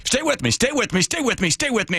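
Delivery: high, pleading stay with me; urgent pilot voice. Retold or imitated. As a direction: urge him to hold on. Pilot urging passenger.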